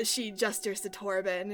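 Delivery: ordinary talk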